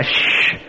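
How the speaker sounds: long unvoiced shush; hushing shh. Preacher quieting Bert's declarations, hushing shh. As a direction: cut in with a sharp hush. Hushing Bert again during service.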